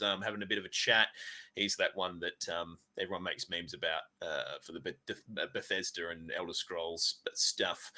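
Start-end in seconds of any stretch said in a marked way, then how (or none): 3.0-7.9 whispered aside. Real, not acted. Trailing aside about memes.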